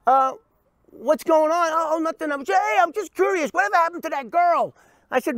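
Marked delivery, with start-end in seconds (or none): 0.1-1.7 high, loud, surprised hello; answering the friend's sudden call, high and surprised; surprised greeting. Retold or imitated. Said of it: "I go". 1.7-4.7 high, mock-casual voice; a hint of the nervous caller, voiced high; imitating the caller. Friend fishing for info.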